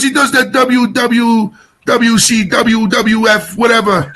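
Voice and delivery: a hint of a Jersey Italian uncle on the phone; Tony Danza-style uncle. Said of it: "he talks like Tony Danza".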